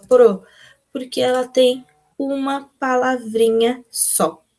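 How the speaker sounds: ordinary delivery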